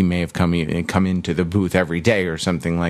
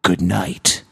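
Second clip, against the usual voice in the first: movie trailer voice. Acted. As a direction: read it like an epic movie trailer. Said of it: "trailer guy voice".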